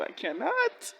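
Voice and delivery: high squeal of I cannot; overwhelmed. Real, not acted. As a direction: cannot rising high in disbelief. Overwhelmed reaction to the show.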